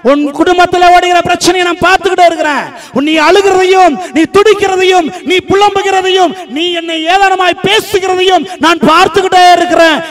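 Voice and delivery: high, loud, mounting list; impassioned preacher speaking as God; full-force preaching. Acted. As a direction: hammer out each phrase from the pulpit. Preaches God's promise forcefully.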